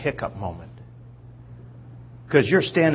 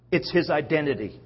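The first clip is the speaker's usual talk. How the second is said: usual speaking voice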